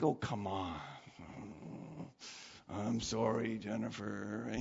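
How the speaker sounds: low, soft, reluctant grumble; acted-out reluctant sorry; grudging apology. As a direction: give in sheepishly, voice dropped. Acting out his grudging apology.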